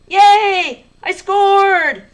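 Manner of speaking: high, loud cheering yell; excited. Shown as a demonstration. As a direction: celebrate a goal out loud. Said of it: "And you'd sound excited".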